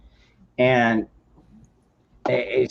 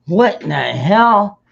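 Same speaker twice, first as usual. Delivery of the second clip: higher, louder "what the hell" of disbelief; shocked outburst; 8 semitones higher, 4 dB louder than usual. Retold or imitated. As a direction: react like you just can't believe it. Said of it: "And I'm like".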